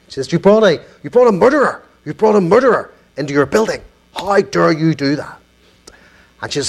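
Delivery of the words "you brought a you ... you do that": fast mock tirade; imitated outraged caller. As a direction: act out a caller's quick, indignant accusation. Retold angry caller accuses him.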